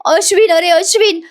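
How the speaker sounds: high-pitched imitation of tearful calling; mock wailing cry. Priya runs in calling for Ashwin, mock tearful wail. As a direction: retell a panicked wail without real volume. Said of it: "रडत बोंबलत मोठ्याने ओरडत असते".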